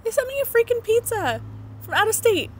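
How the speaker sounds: overwhelmed by the kindness; amazed. Real, not acted. Mom telling viewers about friends' pizza, amazed.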